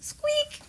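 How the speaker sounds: tiny high mouse squeak; high squeaky mouse noise. Acted.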